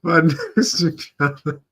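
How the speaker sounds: high, slow, loud words squeezed through laughter; helpless laughing. Real, not acted. Reactor repeats the client's stiff phrase, cracking up. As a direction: barely get the phrase out laughing.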